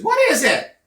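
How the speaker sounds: loud high alarmed question; Mom alarmed. Retold or imitated. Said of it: "She goes".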